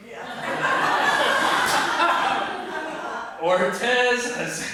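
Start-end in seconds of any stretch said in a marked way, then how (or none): 0.2-3.4 high unvoiced burst of laughter; breathless high laughing; real laugh. Real, not acted. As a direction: crack up helplessly. Laughing at his own story.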